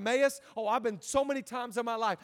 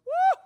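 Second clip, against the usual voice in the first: loud, high-pitched "woo"; acted high whoop; 11 dB louder than usual. Self-righteous man looking around church, acted high whoop. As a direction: let out a mock relieved whoop.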